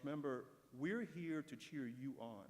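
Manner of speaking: ordinary talk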